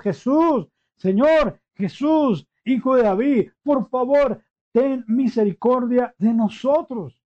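high, loud desperate plea; lepers crying for mercy. Retold or imitated. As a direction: cry out for mercy from afar.

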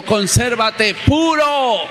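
"Consérvate puro" stretched slow and high for the congregation to echo; call to repeat. Shown as a demonstration. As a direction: lead the church to repeat it. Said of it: "Digan conmigo".

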